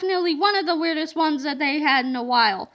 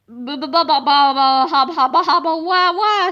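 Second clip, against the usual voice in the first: louder babbling nonsense; 6 dB louder than usual. A narrator's reading. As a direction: blurt gibberish in a stupor. Krupp babbling at wedding.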